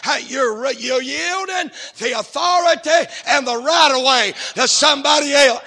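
loud, high preacher's shout; preaching at full force. Acted. Preacher shouting the sermon point to the church. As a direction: drive the point home to the congregation.

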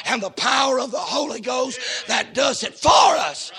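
loud rising preacher's cadence; preaching at full force. Acted. Preacher building to a shout about the wind.

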